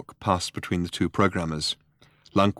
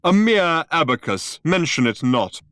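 higher, louder scornful dismissal; contemptuous; 9 semitones higher, 7 dB louder than usual. A narrator's reading. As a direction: sneer it away. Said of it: "with unconcealed contempt".

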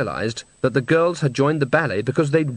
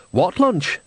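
wide-eyed feigned innocence; innocent; 6 semitones higher than usual. A narrator's reading. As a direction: play dumb, all innocence. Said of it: "said Bucket innocently".

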